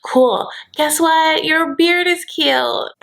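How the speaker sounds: high, drawn-out mock flirting; imitating Brittany. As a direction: dodge the subject with high-pitched cooing. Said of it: "And she's like".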